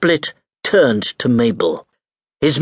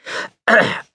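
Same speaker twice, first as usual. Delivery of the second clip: throat clearing; 10 semitones higher than usual. Acted. Pelf prepares to speak.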